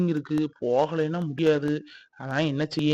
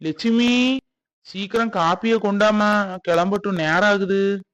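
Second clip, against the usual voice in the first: raised, louder call to someone in another room; 4 semitones higher, 5 dB louder than usual. Acted. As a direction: hurry your wife along from afar. Husband calls wife to hurry coffee. (அவசரமாக உரக்கக் கூப்பிடுவது)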